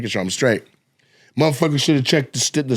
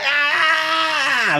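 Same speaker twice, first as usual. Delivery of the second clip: long, high-pitched wail from the front row; drawn-out imitated shriek; Fab; 12 semitones higher than usual. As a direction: scream "ah" in mock hysterics. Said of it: "Fab's in the front".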